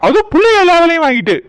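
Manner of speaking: loud husband's telling-off; angry scolding. Acted. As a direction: tear into her accusingly. Husband scolds wife for disappearing.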